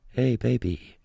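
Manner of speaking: flat robot flirt; deadpan machine voice. Pretend. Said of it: "in the robot voice".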